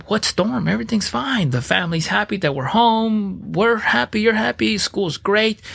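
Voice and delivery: bright, high and carefree, brushing off any storm; sarcastic imitation of a clueless happy self; mock naive cheer. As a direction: play your past self as breezily sure all is fine. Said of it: "I was like".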